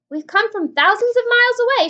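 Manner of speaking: loud, high cheeky shout; centipede shouting back. A narrator's reading. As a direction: holler it down gleefully. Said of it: "shouted the centipede back".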